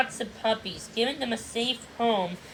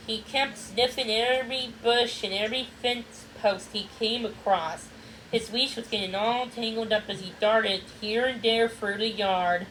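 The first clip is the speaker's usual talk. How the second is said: ordinary delivery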